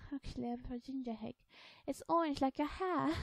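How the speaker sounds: put-on high, little-girl character voice; young girl Alice. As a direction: pipe up as a seven-year-old girl.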